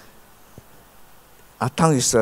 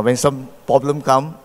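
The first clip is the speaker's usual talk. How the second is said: ordinary talk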